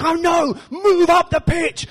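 high, loud, drawn-out holler; hammed-up yell across the pitch; touchline dad shout. Dad yelling at son's match.

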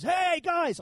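high, imitated tout's greeting; boatman calling out. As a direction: mimic a boatman hailing tourists. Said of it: "this small Greek Cypriot guy goes".

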